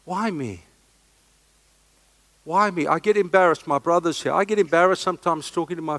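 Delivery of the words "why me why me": soft, hushed, wondering prayer; humbled prayer. Retold or imitated. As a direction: quietly ask why me. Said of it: "Every day I say".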